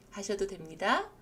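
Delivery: normal talking voice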